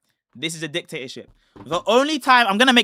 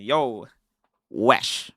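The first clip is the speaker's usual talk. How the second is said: low, quieter yo wesh in disgust; 9 semitones lower, 4 dB quieter than usual. Shown as a demonstration. Teaching cohost slang, demoing a disgusted wesh. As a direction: recoil at something gross. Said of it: "Oh, that's disgusting".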